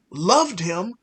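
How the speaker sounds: usual speaking voice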